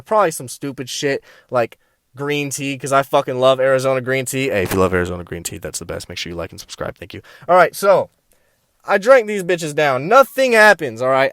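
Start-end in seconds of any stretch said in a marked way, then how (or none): 4.5-7.2 low, soft, rapid plug; hushed, hurried side note; quick muttered aside. Real, not acted. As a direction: drop low and rattle off a plug. Quick aside plugging the channel.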